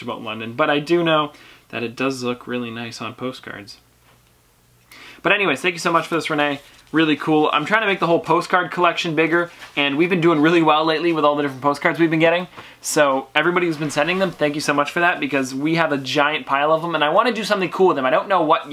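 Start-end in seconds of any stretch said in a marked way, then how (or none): none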